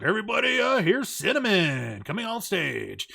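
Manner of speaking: hyped stage introduction voice; strip club DJ. Retold or imitated. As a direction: announce it like a club DJ, stretching words. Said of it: "the D J back there like".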